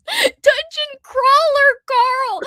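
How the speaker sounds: deliberately slowed title; really slow. Shown as a demonstration. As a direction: inch through each syllable carefully. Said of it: "I have to really slow down".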